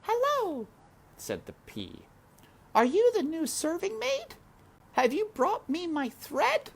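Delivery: very high, sweet royal voice; princess greeting. A narrator's reading. Princess greeting the new maid, high and sweet. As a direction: greet her kindly.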